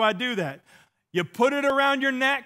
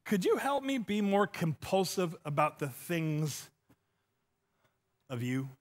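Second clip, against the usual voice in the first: prayer; 3 semitones lower, 7 dB quieter than usual. Retold or imitated. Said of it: "I'm like".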